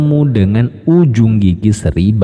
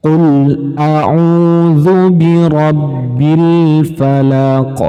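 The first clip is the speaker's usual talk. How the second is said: slow, higher chanted recitation; slow tajwid recitation demo; 5 semitones higher, much slower than usual. Shown as a demonstration. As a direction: recite as in the Quran, slowly. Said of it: "Nah, seperti itu".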